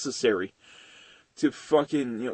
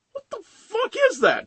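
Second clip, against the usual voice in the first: much higher startled outburst; shocked exclamation. Retold or imitated. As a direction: blurt it out in disbelief. Kid reacting to red eyes.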